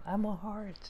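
high, faint little voice from inside. Acted. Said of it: "you hear a little voice coming from".

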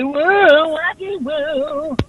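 high, slow silly woo; wacky woo. Retold or imitated. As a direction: stretch it out like cartoon guys. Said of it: "the men say".

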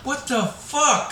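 high, slow, startled outcry; shocked. Retold or imitated. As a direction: react stunned as claws sink in. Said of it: "I was like".

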